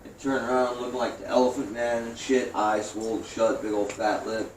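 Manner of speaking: usual speaking voice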